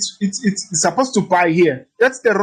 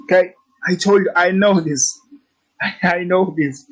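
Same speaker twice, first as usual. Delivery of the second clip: normal talking voice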